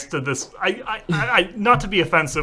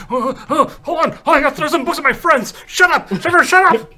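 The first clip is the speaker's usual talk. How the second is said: high, loud, rushed mock panic; mock frantic yelling; 11 semitones higher, 4 dB louder than usual. Mocking a character throwing books at friends, frantic mock yell. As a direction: ham up a frantic outburst. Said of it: "it's like".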